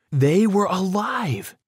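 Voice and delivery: high, stretched cry of relief; slow, soaring, joyful exclamation. A narrator's reading. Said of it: "I cried out".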